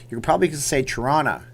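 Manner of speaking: quick Toronto, T dropped, said like Toronna; fast Canadian Toronto, no T. Shown as a demonstration. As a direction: swallow the T in Toronto. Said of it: "But if you're in Canada".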